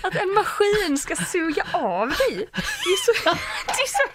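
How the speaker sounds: high, laughing and giggling through words; amused disbelief, laughing hard. Real, not acted. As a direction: crack up while calling it crude. Laughing at crude story.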